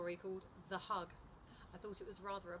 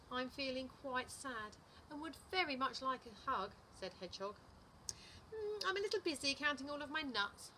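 animated picture-book narration, pitch swinging up; lively storytime voice, key words punched; story reading to children; 5 semitones higher, 4 dB louder, slower than usual. A narrator's reading. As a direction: read it to little children with big expressive emphasis. Reads a picture book aloud.

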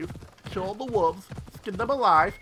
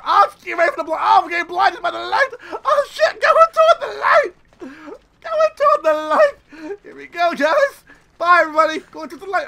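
much higher, loud hammed-up wail; mock dying cries; 11 semitones higher, 9 dB louder than usual. Player hams a death to her viewers, wailing. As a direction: ham up a dramatic death.

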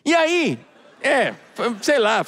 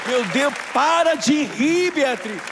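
exasperated plea; 2 semitones higher, much slower than usual. Real, not acted. Begging fiancée to stop laughing.

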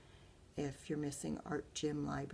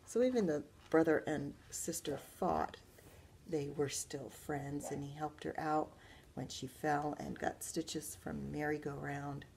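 usual speaking voice

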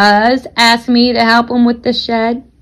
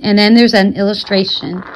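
ordinary delivery

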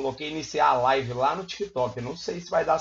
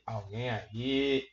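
slow, soft, lower "Alguém aí?" stretched out; drawn-out quiet call; 4 semitones lower, 7 dB quieter, much slower than usual. Pretend. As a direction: ask "Alguém aí?" slowly and softly, as if into an empty room. Calling out to empty live chat. (chamando baixinho e devagar)

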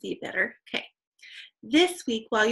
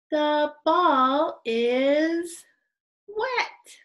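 "The ball is" loud and stretched, "wet" jumps high, then stop; very slow "The ball is", short high "wet"; pause at period; 5 semitones higher, 5 dB louder, much slower than usual. Shown as a demonstration. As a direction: draw out "is", pop "wet" up high. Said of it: "And now we pause or stop".

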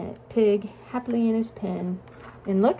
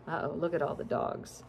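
plain reading voice

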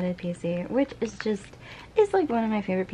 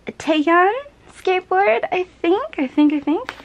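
much higher and louder, squealed; squealing delight; 11 semitones higher, 7 dB louder than usual. Real, not acted. Finding a skateboard card in the package, squealing with delight. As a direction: squeal over the find.

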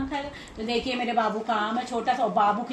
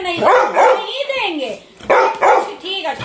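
louder, higher, slow firm no; emphatic promise; 6 semitones higher, 10 dB louder, slower than usual. Real, not acted. Mother assuring daughter brother gets none, loud. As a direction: stretch the no to reassure her. Promising child mango not shared.

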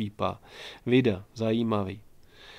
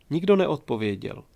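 usual speaking voice

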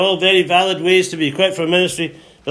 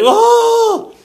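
high, loud startled "oh"; sudden high-pitched yelp of alarm; startled cry; 5 dB louder than usual. Acted. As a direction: burst out as a mock-shocked crowd. Acts out startled congregation.

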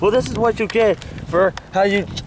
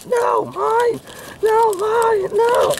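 put-on childish squabble; much slower than usual. Puppets still grabbing the toy.

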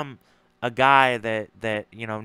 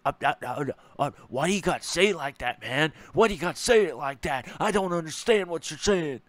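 higher, fast, put-on dumb voice; mocking stammer; 4 semitones higher, faster than usual. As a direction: play him flustered and dodging. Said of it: "you just stuttered".

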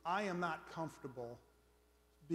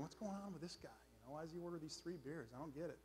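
hushed barroom murmuring; 10 dB quieter than usual. Acted. Bar regulars wondering about the odd newcomer, hushed. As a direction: drop well under your speaking voice.

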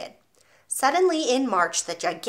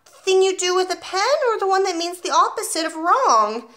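put-on 1 clever small boy; 10 semitones higher, 5 dB louder than usual.

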